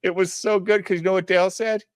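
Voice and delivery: high-pitched, cracking up through the words; laughing while talking. Real, not acted. Storyteller relishing the little girl's answer, laughing hard. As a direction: barely get it out through real laughter.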